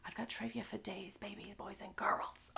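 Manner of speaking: playful whispered brag to the audience. Real, not acted. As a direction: lean in and whisper it like a secret. Teasing hushed aside to audience.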